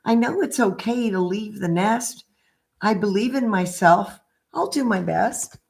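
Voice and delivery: gentle sing-song picture book read; reading to children. Acted. Reads picture book aloud.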